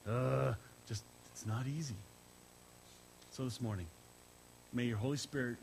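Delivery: soft, hushed congregational prayer; opening prayer before the sermon. Acted. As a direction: pray over the room, gently. Pastor opens with a prayer.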